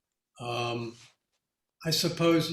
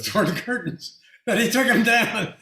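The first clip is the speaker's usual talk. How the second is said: laughing; 7 semitones higher, 6 dB louder than usual. Real, not acted. Laughing through the punchline.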